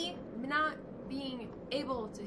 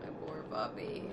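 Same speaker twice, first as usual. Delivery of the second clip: breathy whisper of grief; sad whisper; 5 semitones lower, 11 dB quieter than usual. Real, not acted. Gamer grieving his dead puppy in a whisper. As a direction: barely voice it, crushed. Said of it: "I'm so upset".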